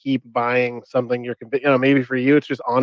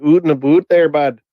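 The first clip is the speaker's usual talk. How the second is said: mock Maritime Canadian, a bit louder; thick East Coast Canadian accent; 3 semitones higher, 7 dB louder than usual. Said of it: "Out east, they're like".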